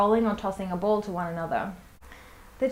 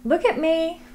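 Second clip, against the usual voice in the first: higher, brighter call for attention; teaching cue; 7 semitones higher than usual. Shown as a demonstration. Educator getting toddler Briar's attention, higher pitched cue. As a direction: lift the voice to catch a toddler's eye. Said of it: "my teaching cues might be".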